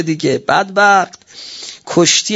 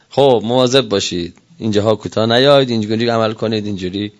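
mock leader instructing; 8 semitones lower than usual.

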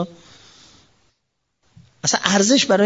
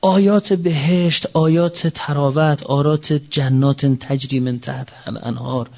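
ordinary talk, nothing marked

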